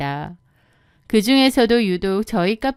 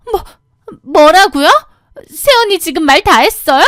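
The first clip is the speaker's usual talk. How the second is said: high, loud outraged gasp of words; outraged, can't believe it; indignant shock; 7 semitones higher, 8 dB louder than usual. Acted. Sister-in-law shocked at being scolded, indignant.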